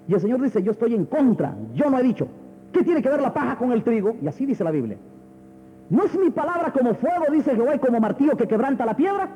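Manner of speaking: high, ringing scripture declamation; indignant prophetic questioning; forceful preaching. Acted. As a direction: hurl God's challenge at the congregation. Preaches God's words from scripture.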